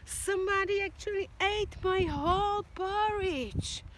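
put-on high, loud little baby bear; upset baby bear wail. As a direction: squeak it out like the smallest bear. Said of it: "And baby bear went".

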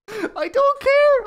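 high, drawn-out words through laughter; helpless laugh spilling into the words. Real, not acted. Host brushes off his crude joke, laughing high.

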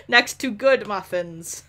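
usual speaking voice

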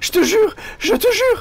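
panicked pleading. Pretend. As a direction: beg like you've been caught. Caught by guard, pleading.

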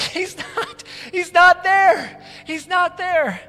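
high, half-laughing, half-crying; joy spilling over into laughter; laughing through tears. Acted. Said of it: "they're going".

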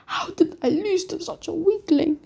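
high, incredulous question; indignant disbelief. Acted. Naruto taunted over losing to a weakling, indignant.

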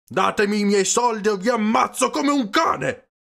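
high, loud, furious yell; enraged shouting, strained high; screamed threat. A narrator's reading. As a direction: threaten at full voice. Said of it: "urlò Peppone".

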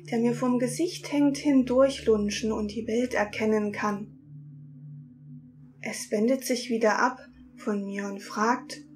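usual speaking voice

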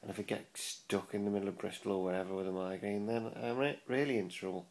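usual speaking voice

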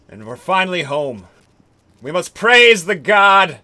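normal talking voice

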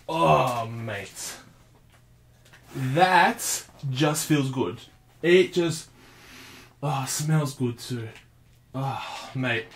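savouring, hushed. Real, not acted. Savouring the new controller.

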